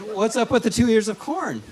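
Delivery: puzzled question. Shown as a demonstration. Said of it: "it's good".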